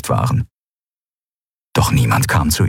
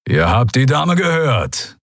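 growled; 6 semitones higher, slower than usual. Acted. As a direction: growl it as a warning. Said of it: "knurrte Keru".

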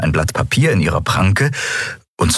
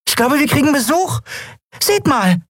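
much higher, lively alerting voice; Nelen's voice. A narrator's reading. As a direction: point the others to the visitor. Nelen's character voice.

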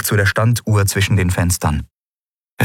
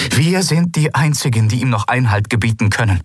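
higher, fast, freshly energised declaration; rally them with sudden resolve; filled with new energy; 7 semitones higher than usual. A narrator's reading. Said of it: "von neuer Energie erfüllt". (voller neuer Energie)

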